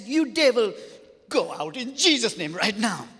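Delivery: loud, high rebuke of the devil; angry command. Retold or imitated. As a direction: shout the devil out with fury. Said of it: "I got angry with the devil and I said".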